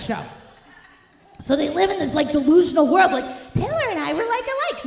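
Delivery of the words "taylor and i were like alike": high, mock-dreamy fan voice. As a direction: ham up a starstruck woman, much higher. Mocking middle-aged Swift fans.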